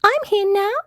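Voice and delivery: very high little mouse voice; Teeny. A narrator's reading. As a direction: chirp it as a tiny mouse. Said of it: "said Teeny".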